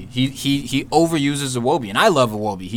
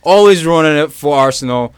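loud, raised rant; excited blast into the mic; 3 semitones higher, 5 dB louder than usual. Real, not acted. Fan rants against Arsenal's manager, excited and loud. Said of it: "real close up to the microphone. Sorry to blast".

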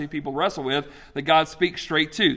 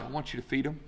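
calm God voice; 2 semitones lower, 9 dB quieter, faster than usual. Pretend. God answers angel.

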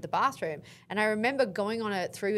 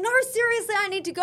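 higher, louder urgent pleading; desperate boyfriend; 12 semitones higher, 5 dB louder, faster than usual. Retold or imitated. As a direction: plead that it can't wait.

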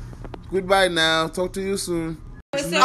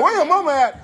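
mock question; 7 semitones higher than usual. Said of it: "She said".

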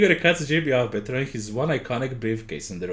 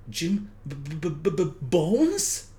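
stammering fear; 3 semitones higher than usual. Acted.

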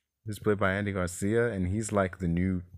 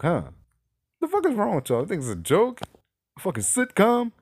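high-pitched, incredulous ranting; baffled indignation, pitched very high; exasperated outburst; 9 semitones higher, 4 dB louder than usual. Real, not acted. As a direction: demand an explanation, voice leaping way up. Exasperated outburst at sitcom writing.

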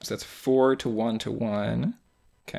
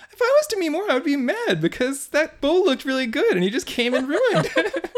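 high-pitched imitation of mom; squeaky mom impression; 13 semitones higher, 4 dB louder than usual. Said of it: "she's like".

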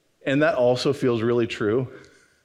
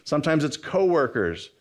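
ordinary talk, nothing marked

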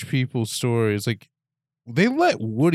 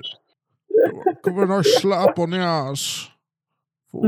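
silly put-on voice; 7 semitones higher, slower than usual. Bond-style quip, silly voice.

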